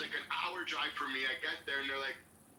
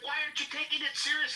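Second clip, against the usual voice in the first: fast, high, loud mimicked protest; losing their shit; 10 semitones higher, 5 dB louder, faster than usual. Panicked caller pleading with volunteers to act, mock frantic outrage. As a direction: imitate a frantic caller demanding action. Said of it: "they were losing their shit".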